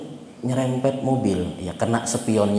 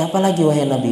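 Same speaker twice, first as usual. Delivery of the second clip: higher-pitched, mildly insistent question; retold eager plea, pitched up; unsatisfied asking; 6 semitones higher, 4 dB louder, faster than usual. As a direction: lightly act out a follower pressing for more. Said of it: "dia merasa nggak puas".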